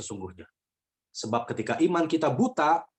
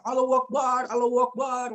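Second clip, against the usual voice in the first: high-pitched imitation of frightened prayer; panicked crying out; 9 semitones higher than usual. Passengers calling on God as the plane falls, panicked cry. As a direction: mimic passengers wailing to God. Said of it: "ada yang teriak".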